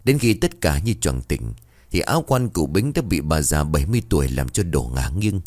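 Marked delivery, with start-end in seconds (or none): none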